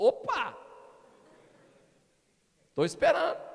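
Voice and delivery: loud, high, playful call; Espírito Santo. Retold or imitated. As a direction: pounce with a mock-eager shout, as if waiting all along. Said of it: "o Espírito Santo falou". (chamado alto e agudo, encenado)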